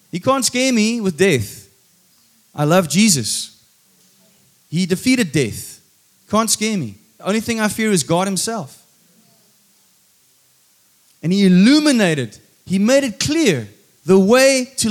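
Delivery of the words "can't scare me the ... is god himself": usual speaking voice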